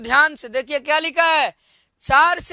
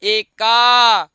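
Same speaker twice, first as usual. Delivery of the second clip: drawn-out, slightly louder एका; slow recitation; 5 dB louder, much slower than usual. Shown as a demonstration. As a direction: stretch एका out for the class. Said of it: "और वही स्त्रीलिंग में".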